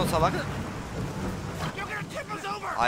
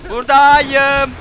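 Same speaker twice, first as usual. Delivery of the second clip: slow, louder drawn-out I'm here; sing-song taunt; 5 semitones higher, 7 dB louder, much slower than usual. Acted. Gamer showing himself to the boss, slow sing-song taunt. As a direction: tease the enemy with it.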